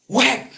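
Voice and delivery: a high mock whack sound; cleaver whack. Splitting the coconut with the cleaver, sharp high whack sound.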